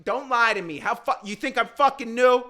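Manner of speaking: high, loud, hammed-up indignant shout; mock outraged yell. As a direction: snap back at a liar, all in outrage. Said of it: "And I'm like".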